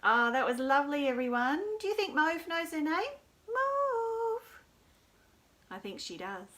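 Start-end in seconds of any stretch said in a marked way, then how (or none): 3.5-4.6 a squeaky high puppet voice; high little puppet voice. Acted. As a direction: voice the toy dog saying its name. Puppet answers its name.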